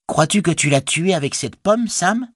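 high-pitched hobbit voice, questioning; hobbit asking his companion. Acted. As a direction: lift the voice well up for the hobbit's question. (voix de hobbit haut perchée, interrogative)

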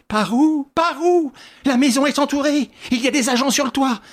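high, loud agitated demand; frantic. Acted. Inspector frantically insisting to the chief, high and loud. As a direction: fire the questions at his chief.

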